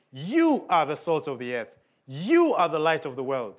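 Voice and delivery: "You" stressed at the start of each line; "You are the salt", "You are the light" with weight on "You"; emphatic you. Shown as a demonstration. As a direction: lean hard on "You" both times. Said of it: "It's emphatic".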